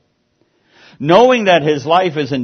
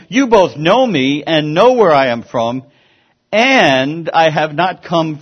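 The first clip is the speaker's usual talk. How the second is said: raised preaching voice, drawn-out words; crying out; 3 semitones higher, 7 dB louder, slower than usual. A narrator's reading. Preacher reading Jesus' words in the temple, crying out. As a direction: read it out loudly, with weighty emphasis. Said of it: "Jesus cried out in the temple".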